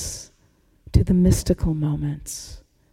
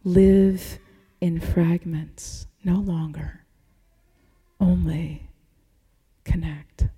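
slow, hushed and deliberate; solemn refrain; 2 semitones lower, slower than usual. Acted. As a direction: let each phrase land slowly. Sermon refrain, slow and soft.

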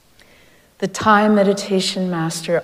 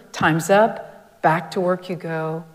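usual speaking voice

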